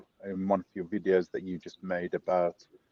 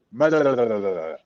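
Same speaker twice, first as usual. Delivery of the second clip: imitating unintelligible driver; 4 dB louder than usual. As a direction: rattle off nonsense like incomprehensible speech. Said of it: "I don't understand people in Scotland".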